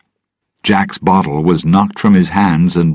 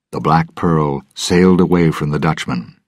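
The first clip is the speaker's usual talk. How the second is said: ordinary delivery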